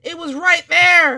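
loud, stunned burst; right after a jump scare, loud and shocked; shocked outburst. Real, not acted. Jump scare just happened.

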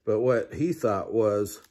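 usual speaking voice

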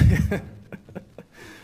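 short embarrassed breathy laugh. Real, not acted. Embarrassed after gulping ice.

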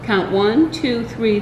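ordinary delivery

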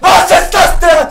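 loud, high, slowed disbelief; outraged shout. Real, not acted. Outraged at the game.

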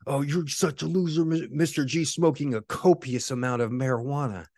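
high, mocking taunt; mocking troll. Troll taunting the streamer from his live chat, high mocking voice. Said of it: "a troll came in there and he's like".

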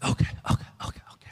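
imitating a player's quick, whispered okays; hushed agreement. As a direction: whisper it like a kid nodding along. Acting out Evan agreeing to play.

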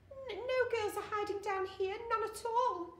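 high, stammering nervous squeak; squeaky voice. A narrator's reading. Attendant hiding the girl from looming men, squeaky denial. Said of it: "in a squeaky voice".

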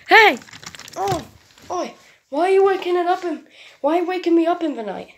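loud fast startled complaint; startled yelling. Acted. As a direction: yell as if jolted awake. Owner woken up, yelling.

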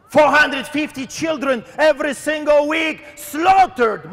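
impassioned rebuke in rising questions; fiery preaching. Real, not acted. Preacher confronts Canada over its sins, fiery preaching. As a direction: challenge the listeners, pressing each accusation.

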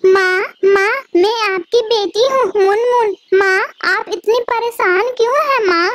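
newly hatched chick calling its mother; baby bird. Acted. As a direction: newborn chick asking why her mother is worried. Newborn chick speaks to mother.

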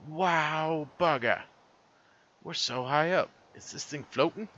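put-on high-pitched, botched Aussie-British accent; Australian kid turned British. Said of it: "she is going to become British".

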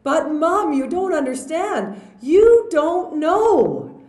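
put-on high, loud pleading kid voice; mock whiny teen pleading, loud and high; upset son. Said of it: "And he says".

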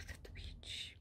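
hissed whisper of contempt; whispered "That witch.". Real, not acted. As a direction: breathe it out in a whisper.

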